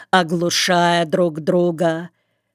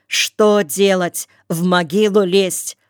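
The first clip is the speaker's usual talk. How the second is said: plain reading voice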